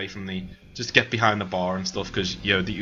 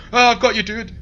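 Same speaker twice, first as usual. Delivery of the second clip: high, loud frantic call; panicked shout; 12 semitones higher, 7 dB louder than usual. Acted. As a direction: leap in with a panicky yell. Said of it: "spring up at this in panic".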